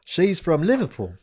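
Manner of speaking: put-on high-pitched British accent for a backstory; put-on British accent, pitched right up on "mummy". Inventing a Liverpool mum for his cover story, high British accent. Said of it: "Talked to him in a British accent".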